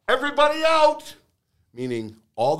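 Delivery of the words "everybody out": high-pitched mock yell, held back in volume; imitated yell. News director sending all the reporters out, imitated high-pitched yell. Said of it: "the news director yelling".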